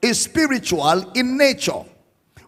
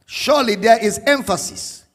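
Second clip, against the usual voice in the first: ordinary talk, nothing marked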